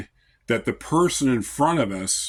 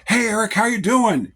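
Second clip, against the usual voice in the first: friendly greeting; 7 semitones higher than usual. Retold or imitated. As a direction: imitate a once-rude customer now greeting you happily. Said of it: "he's like".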